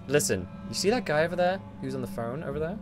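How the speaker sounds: ordinary talk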